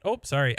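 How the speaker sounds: "Oh" closed with a P, as "ope"; Midwestern ope. Shown as a demonstration. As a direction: say "Oh" as a quick startled ope before sorry. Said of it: "I do say a lot of ope".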